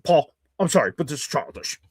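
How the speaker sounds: lightly voiced disapproving viewer; a hint of mock disapproval, dropping away at the end; imitating a chat commenter. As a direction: mimic the viewer typing his objection. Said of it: "I can only even see, [name], you saying that".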